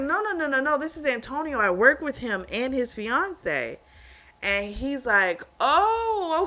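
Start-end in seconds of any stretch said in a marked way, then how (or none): 0.0-3.8 higher, hurried no no no in her voice; high quick denial. Retold or imitated. She corrects him fast, higher, insisting. 5.6-6.4 high, relieved oh of surprise; surprised oh. Retold or imitated. He learns she's not taken, surprised high oh. He realises it's a coworker.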